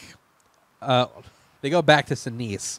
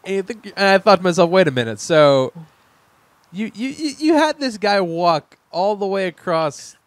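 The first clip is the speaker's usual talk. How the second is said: louder and higher, building disbelief; incredulous outburst; 5 semitones higher, 8 dB louder than usual. Real, not acted. Host ranting to cohost about a pointless death, loud and incredulous. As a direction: rant as if the plot betrayed you. Outraged at pointless plot twist.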